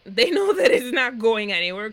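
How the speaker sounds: loud, fed-up exclamation; exasperated outburst. Real, not acted. Host calling out the couple's fake laughter, loud exasperation.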